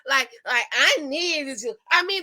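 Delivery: ordinary delivery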